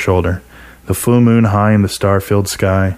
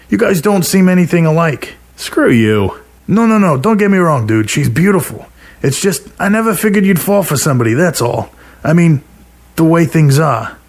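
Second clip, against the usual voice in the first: a hint of a higher, teasing buddy voice; lightly voiced buddy Mike; 9 semitones higher than usual. A narrator's reading. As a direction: raise the pitch for Mike, casual and sly. Mike voiced, high pitched.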